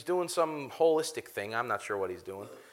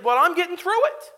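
high, louder upbeat quote; chirpy and raised in pitch, imitating an upbeat friend; chipper voice; 11 semitones higher, 6 dB louder than usual. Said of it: "he's got this chipper voice".

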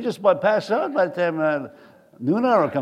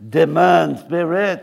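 put-on slow, loud joking French accent; 5 dB louder, slower than usual. Preacher joking that he's half French, playful French accent. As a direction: ham up a French accent, stretched out. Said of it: "Y'all didn't know I was half French".

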